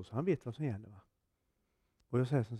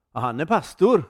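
loud blurt; 3 semitones higher, 11 dB louder than usual. Retold or imitated. Blurting out at a party.

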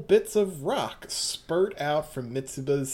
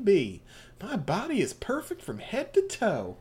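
Southern Belle; 4 semitones higher, 3 dB quieter than usual. Acted. GM voicing Mitsuba to Yasuho as a sweet Southern belle. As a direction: play the genteel Southern lady, all honey. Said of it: "doing her Southern Belle".

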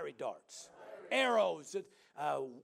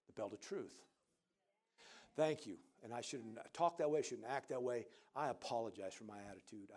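much softer and lower, sheepish apologising; mock humble apology; 10 semitones lower, 9 dB quieter than usual. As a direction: play it meek and apologetic.

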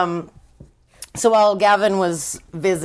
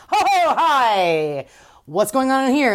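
awkward mock cheer; 6 semitones higher than usual. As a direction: stall with a nervous fake greeting. Dawn awkward interrupting.

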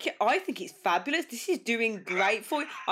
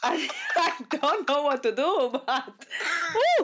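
higher, laughing through the words; flustered giggling; 5 semitones higher, slower than usual. Real, not acted. Host flustered by guest's accent, giggly and overwhelmed. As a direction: giggle helplessly, ending on a woo.

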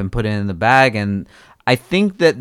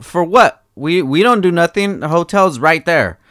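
raised, incredulous protest to the police; indignant protest; 7 semitones higher than usual. Retold or imitated. Telling the police officers he did nothing, indignant and raised. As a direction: protest to the cops, higher pitched. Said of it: "I was like".

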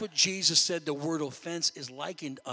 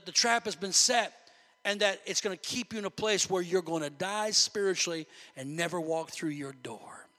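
ordinary talk

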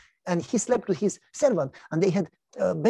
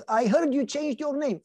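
much higher mocking neighbor voice; teasing neighbor; 8 semitones higher than usual. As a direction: needle him like a nosy neighbor. Neighbor Jim teases Abraham.

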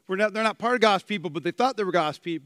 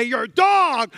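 loud, higher, harsh accusation; insulting shout; 5 semitones higher, 6 dB louder, slower than usual. Shown as a demonstration. Preacher showing how context changes words, DOG shouted as insult. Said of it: "You can say".

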